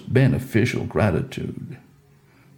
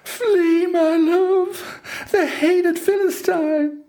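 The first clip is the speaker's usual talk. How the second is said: very high falsetto cry of warning; urgent wail, pitched far up as Delilah; Delilah crying out urgently; slower than usual. A narrator's reading. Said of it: "She urgently cried out".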